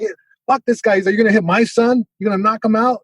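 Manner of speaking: mock indignant dad; dad impression. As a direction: lightly act an outraged, protective father. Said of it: "impression of your dad".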